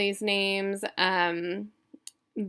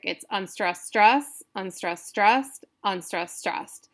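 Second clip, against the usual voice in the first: weak-strong rhythm on unstressed, stressed; iambic beat. Shown as a demonstration. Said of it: "it's all iambic".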